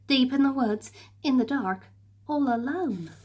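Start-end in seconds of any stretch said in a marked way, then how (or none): none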